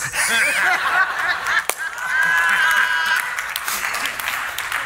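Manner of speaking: big real belly laugh; long loud laugh. Real, not acted. Preacher breaks into laughter.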